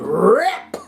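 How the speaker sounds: loud, high "Rip!" in a Madea voice; Madea-style rip. Retold or imitated. Telling viewers how she tore the picture, Madea style. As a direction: bark "Rip!" like Madea mid-tantrum. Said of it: "I was doing a Medea".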